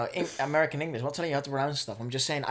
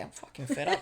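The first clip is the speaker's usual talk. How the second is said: mock fed-up; 10 semitones higher, 7 dB quieter than usual. Joking complaint to sister.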